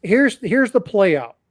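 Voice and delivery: ordinary talk, nothing marked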